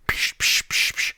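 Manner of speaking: whispered psh drill sounds; imitated drill noise. Describing dad's drilling, drill sound effects. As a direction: mimic the drill with sharp puffs.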